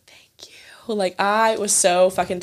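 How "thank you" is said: breath-only grateful whisper; retold hushed, voiceless aside; whispered thanks. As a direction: whisper it as a secret thank-you. Retold secret thanks to friend.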